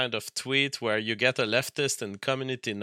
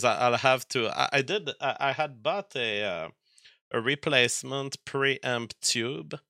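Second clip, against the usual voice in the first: ordinary delivery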